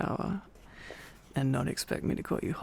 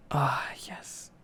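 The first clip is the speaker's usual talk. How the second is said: whisper. Acted. Whispered reply to tea.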